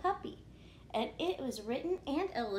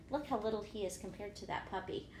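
usual speaking voice

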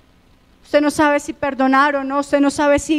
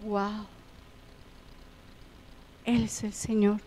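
hushed, softer, in awe; awed hush; 4 semitones lower, 16 dB quieter than usual. Retold or imitated. The repentant thief realizing who Jesus is, softer and awed. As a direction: breathe it out in wonder.

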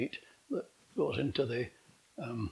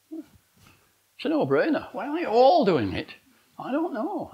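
exasperated outburst; 9 semitones higher, 7 dB louder than usual. Real, not acted. Exasperated rhetorical plea to audience.

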